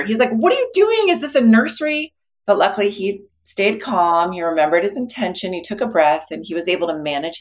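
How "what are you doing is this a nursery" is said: much higher, louder mock-furious outburst; imitating an angry shopper's outburst; exasperated outburst. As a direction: blow up like an impatient man stuck in line. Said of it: "he almost explodes in anger".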